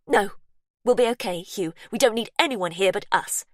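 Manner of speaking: cling to the house; stubborn refusal. Acted. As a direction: sister refusing anyone's help. Sister refusing outsiders.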